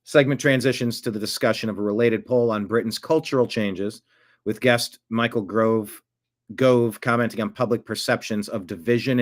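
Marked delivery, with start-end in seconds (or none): none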